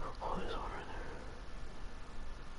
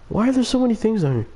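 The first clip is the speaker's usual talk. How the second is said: alarmed; 10 semitones higher, much faster than usual. Real, not acted. Sudden alarm in game.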